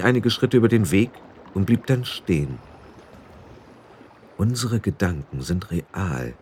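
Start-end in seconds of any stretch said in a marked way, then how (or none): 4.4-6.3 softer, slower thought; thinking to himself quietly; inner thought. A narrator's reading. Roland thinking before facing the danger, soft slow inner voice. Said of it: "dachte er".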